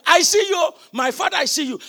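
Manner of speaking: a loud cry that settles back down. Acted. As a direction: call out to the departing father. Elisha crying to Elijah.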